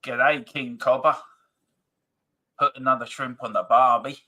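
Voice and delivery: a lightly voiced bad Aussie; worst Aussie accent. Host doing his bad Aussie accent for a viewer. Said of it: "I do the worst Aussie accent going".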